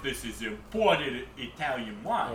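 slow mock Chicago Italian accent. Wine scammer selling fake wine in a Chicago Italian accent. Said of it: "that accent you just did".

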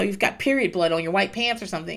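normal talking voice

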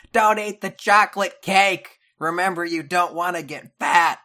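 loud, high mock shout; shouting fridge. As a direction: yell a nagging reminder as the fridge. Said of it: "your fridge starts shouting at you".

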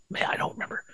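breathy whisper, to himself; whispered aside. Real, not acted. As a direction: drop to a whisper mid-thought.

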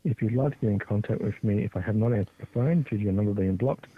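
ordinary delivery

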